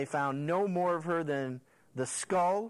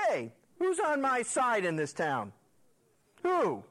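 high, raised call to a window; 7 semitones higher than usual. Acted. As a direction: holler up to them as an acted commander. Jehu calling up to the window.

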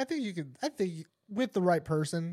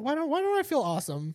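pitched-up puzzled whine; high confused whine; 9 semitones higher than usual. Retold or imitated. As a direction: squeak it out, baffled. Retold confused self.

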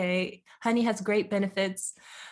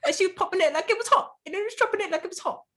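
rushed tongue twister; 9 semitones higher, 3 dB louder, faster than usual. Shown as a demonstration. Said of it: "say the sentence back to me".

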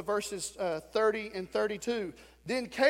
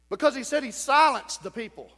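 ordinary delivery

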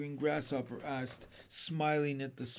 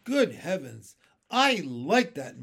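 loud, emphatic protest; punchy, mock-offended outburst; indignant exclamation; 8 dB louder than usual. A narrator's reading. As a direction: exclaim as if affronted. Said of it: "green grasshopper cried".

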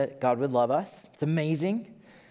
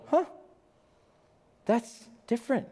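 mildly surprised listener; 10 semitones higher than usual. Retold or imitated. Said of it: "listen now and go".

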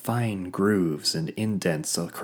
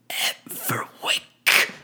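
slow, strained whisper; pained; much slower than usual. A narrator's reading. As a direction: force it out in pain. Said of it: "louder and more pained than before".

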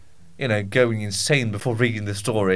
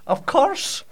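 higher, sing-song put-on Scottish voice; a daft Scottish shepherd, pitched up high; mock Scottish accent; 6 semitones higher than usual. Practicing the Scottish detective voice, high mock Scottish accent. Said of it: "I should just practice it".

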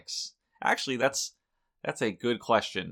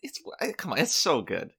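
excited pleading; 9 semitones higher, 3 dB louder than usual. Real, not acted. Pleading to tell story.

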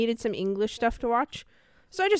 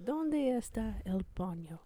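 Spanish phrase; 9 dB quieter, slower than usual. Shown as a demonstration.

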